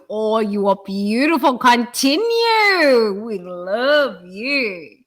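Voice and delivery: high, slow, teary loving coo; voice of the universe. Pretend. Fish and universe telling snorkeling speaker she is loved, slow teary high coo. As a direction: let the ocean speak to you with tender tears, very slowly. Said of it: "the universe is looking at me going".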